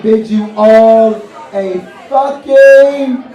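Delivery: slow, punched, shouted goodnight; loud sign-off. Real, not acted. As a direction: land the goodnight big and slow.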